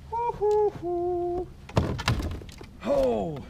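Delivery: high, slow sing-song woo-hoo; a soft crow of joy; giddy whoop. Real, not acted. Celebrating the fish.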